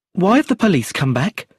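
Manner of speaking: high, anxious question; a nervous woman watching the police return. A narrator's reading. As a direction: ask it with rising nerves. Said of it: "said Brenda nervously".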